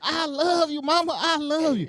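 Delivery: high, hammed-up plea to mama; retold pleading yell. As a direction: imitate a kid begging mid-whooping. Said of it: "And I start yelling".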